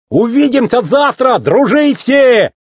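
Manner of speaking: high, drawn-out holler; shouted farewell. A narrator's reading. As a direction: call goodbye while riding away. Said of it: "крикнул мэтр".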